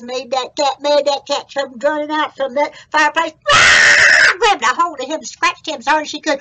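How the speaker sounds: loud, high storytelling at the attack; hollered, breakneck-drama narration; excited story narration. A narrator's reading. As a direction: build the action to a holler.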